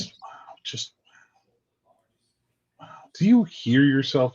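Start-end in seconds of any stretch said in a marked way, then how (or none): 0.0-2.9 breathy disbelief. Real, not acted. Stunned reaction to partner's remark.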